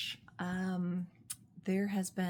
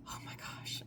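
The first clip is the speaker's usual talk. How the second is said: soft whispered awe; hushed awestruck gasp; breathless whisper; 11 dB quieter than usual. Real, not acted. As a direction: breathe it out overwhelmed. Overwhelmed with love of book.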